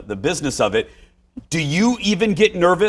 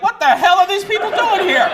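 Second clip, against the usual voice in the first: baffled locals; 4 dB louder than usual. Retold or imitated. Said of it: "People in Coon Rapids were like".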